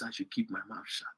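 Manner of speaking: quiet, mocking mimicry of his words; mocking quote. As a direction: mutter it back as his timid advice. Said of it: "And yet he says".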